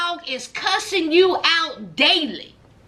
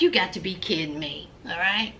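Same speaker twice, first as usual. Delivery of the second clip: low, soft, fast scoff; dismissive low mutter of an ex-con; scoffing Jason; 10 semitones lower, 7 dB quieter, faster than usual. Retold or imitated. Jason brushing off young Travis, low fast scoff. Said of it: "Jason was like".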